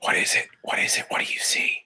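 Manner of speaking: hushed urgent whisper. Acted. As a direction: whisper it nervously over your shoulder. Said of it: "he's sort of whispering over his shoulder".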